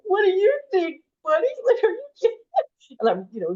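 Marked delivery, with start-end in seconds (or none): 0.0-2.4 high, loud mock-incredulous comeback; incredulous sarcastic jab at the life coach, pitched high and loud; sarcastic retort. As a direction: scoff at him in a much higher, louder voice with a laugh. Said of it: "doing one of those".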